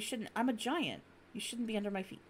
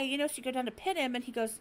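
usual speaking voice

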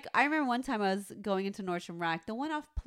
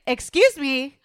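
loud, high, indignant challenge; big attitude; 4 semitones higher, 11 dB louder, slower than usual. Retold or imitated. Retelling to her friend how she confronted the car intruder, big attitude. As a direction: call it out sharply with attitude. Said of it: "I said it with a big ass attitude".

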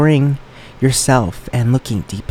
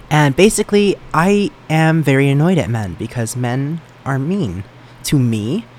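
usual speaking voice

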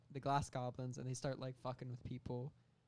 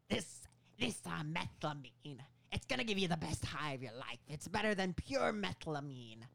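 theatrical dealer with swooping pitch; Willem Dafoe; 3 semitones higher than usual. Acted. Meth dealer explaining his product, Willem Dafoe impression. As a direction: sell it like a hammed-up pusher. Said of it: "Willem Dafoe goes on to explain".

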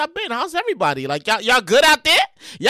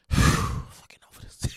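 sighing, fed up; 7 dB quieter, slower than usual. Real, not acted. Fed up with the Giants.

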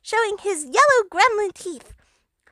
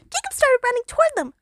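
ordinary delivery, nothing marked

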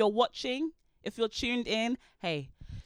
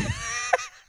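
high loud burst of laughter; shrieking laugh; 4 dB louder than usual. Real, not acted. Laughing after shouting.

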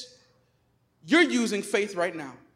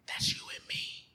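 whispered aside; 13 dB quieter than usual. Real, not acted. Pastor whispering to the congregation, intimate. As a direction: whisper it close.

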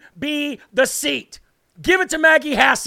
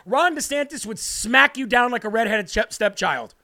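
usual speaking voice

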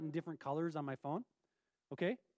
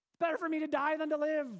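dramatic quote; 7 semitones higher, 5 dB louder, faster than usual. Acted. Reads Jonah's complaint dramatically.

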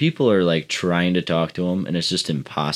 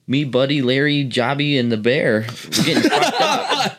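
high, put-on dopey phone greeting; hammed-up wasted caller, climbing into a high yell; imitated wasted caller; 6 semitones higher than usual. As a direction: mimic a messed-up guy, rising much higher at the end. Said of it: "he goes".

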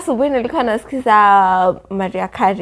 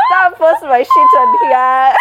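laughing protest; 8 semitones higher, 5 dB louder, slower than usual. Real, not acted.

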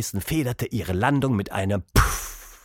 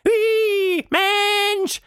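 very high, louder squeal of fright; high shriek; 5 dB louder than usual. A narrator's reading. The frightened mouse spotting a human, high shriek. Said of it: "stieß ein hohes Kreischen hervor".

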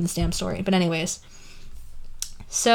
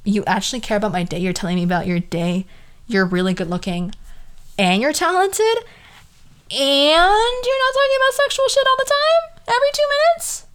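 mock amazed; 7 semitones higher than usual. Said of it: "I was like".

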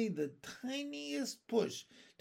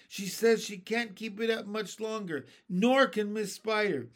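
raised, higher calling voice; moderately loud, strained-for-attention call; yelled warning; 5 semitones higher, 4 dB louder than usual. A narrator's reading. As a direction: call up an urgent report. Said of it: "yelled the centipede from below".

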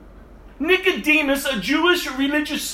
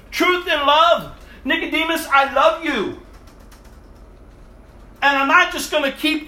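preaching Jesus; 3 semitones higher than usual. Pretend. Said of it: "He turns and he says".

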